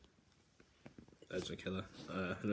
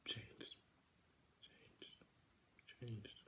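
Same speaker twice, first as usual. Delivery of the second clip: "Changed" said three times, fading to a whisper; fading echo; 13 dB quieter, much slower than usual. Pretend. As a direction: let each "Changed" die away like an echo. Echoing dramatic repeat.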